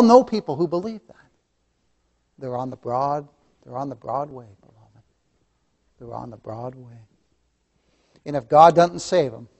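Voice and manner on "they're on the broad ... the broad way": hushed lament. Acted. Solemn lament, soft falling.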